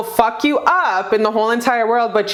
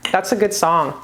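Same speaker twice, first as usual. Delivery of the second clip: usual speaking voice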